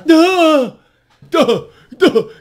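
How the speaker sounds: loud, high, punched-out duhs; big mocking exclamations; shouted duh. Shown as a demonstration. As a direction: hurl each duh out separately.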